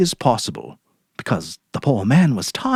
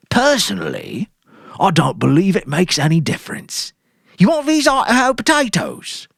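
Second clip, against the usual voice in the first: higher, easy shopkeeper voice; friendly grocer; 9 semitones higher than usual. Acted. Sam the grocer chatting to Mary, friendly. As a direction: chat helpfully to a regular.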